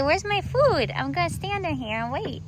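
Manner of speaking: pet's voice. Pretend. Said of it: "You say".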